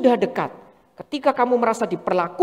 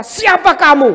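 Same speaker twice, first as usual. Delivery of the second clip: higher, louder indignant snap; indignant challenge; 5 semitones higher, 9 dB louder than usual. Retold or imitated. Believer voiced grumbling at an oppressor, indignant snap. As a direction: challenge them, offended. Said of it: "Kita mulai berkata".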